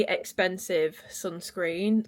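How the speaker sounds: ordinary talk, nothing marked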